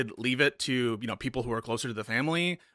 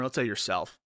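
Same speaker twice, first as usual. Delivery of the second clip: ordinary talk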